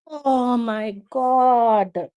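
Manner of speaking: very slow, drawn-out lament; despairing groan. Real, not acted. Fan despairing in additional time, drawn-out groan. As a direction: stretch every word out in despair.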